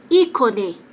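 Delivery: "Ícone" com o I inicial mais forte; tônica no I. Shown as a demonstration. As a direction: bata na primeira sílaba de "Ícone". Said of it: "o I é mais forte". ("Ícone" proparoxítona, I destacado)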